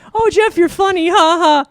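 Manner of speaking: high, put-on voice of a fawning girlfriend; mock a girl's adoring fake laugh. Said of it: "I've had several girls get sick of".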